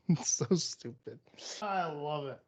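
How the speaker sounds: soft, breathy laugh through the words. Real, not acted. Host cracking up at their own dumb bit, laughing. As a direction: trail off softly while laughing.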